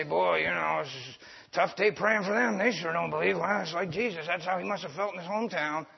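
a hint of a defeated church member shrugging; resigned believer. Believers excusing a failed prayer, low and resigned. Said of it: "we turn and say".

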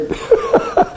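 high, breathy laugh; helpless little burst of laughter. Real, not acted. Preacher laughs at setting men straight, amused.

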